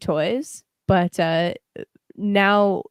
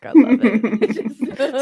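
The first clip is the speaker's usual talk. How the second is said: slow, laughing delight; laughing at how chaotic the game is; 4 semitones higher, 6 dB louder, much slower than usual. Real, not acted. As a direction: savour it through a laugh.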